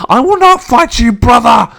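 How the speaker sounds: high, loud defiance; shouted refusal. Acted. Chained brother refusing to fight, shouting. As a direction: cry out in refusal.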